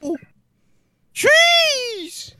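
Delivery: high, loud whooping cry; excited. Acted. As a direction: whoop like a thrilled ad guy. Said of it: "This guy's excited".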